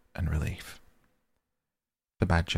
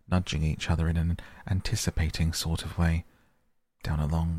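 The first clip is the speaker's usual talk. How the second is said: plain reading voice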